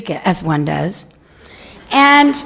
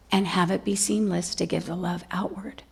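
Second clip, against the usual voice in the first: whisper; 4 semitones lower, 11 dB quieter than usual. Real, not acted.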